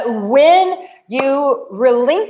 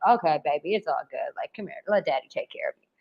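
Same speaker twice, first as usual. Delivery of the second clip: low and much softer, tender; soothing; 8 semitones lower, 9 dB quieter, faster than usual. Retold or imitated. Man comforting his whining partner tenderly. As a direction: comfort her like a gentle daddy. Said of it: "for a little bit".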